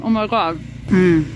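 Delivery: ordinary talk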